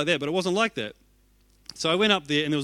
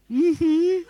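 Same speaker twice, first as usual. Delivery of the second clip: much higher and louder strained hum through tears; choked-up mm-hmm; 13 semitones higher, 6 dB louder than usual. Retold or imitated. Crying pastor accepting the speaker's hug, choked hum. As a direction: squeeze it out while fighting tears.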